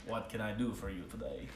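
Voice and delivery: a badly done dwarf greeting a customer; terrible dwarf voice. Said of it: "That was, that was terrible. I hated that voice".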